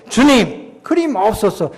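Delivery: loud, high protest to the Lord. Retold or imitated. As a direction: object with urgency. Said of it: "항변이란 건".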